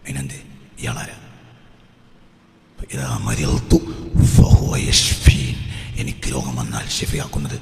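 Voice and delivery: low, husky near-whisper; hushed preaching. Real, not acted. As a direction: drop to a confiding hush for the congregation. (പതിഞ്ഞ സ്വരത്തിലുള്ള ഉപദേശം)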